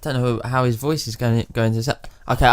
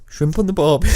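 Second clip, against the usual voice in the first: broad mock Australian, slightly raised pitch; Australian accent; 4 semitones higher than usual. Shown as a demonstration. Practising before reading a message aloud, Australian accent. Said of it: "Let me have a little practice".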